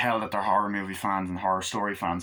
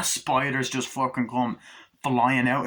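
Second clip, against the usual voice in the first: ordinary delivery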